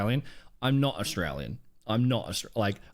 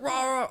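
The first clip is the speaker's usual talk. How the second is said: high-pitched imitated "rah, rah"; high, mocking rah-rah; mock ranting noise; 11 semitones higher than usual. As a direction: mimic a wild outburst in wordless rahs. Mimics the loose guy's outburst.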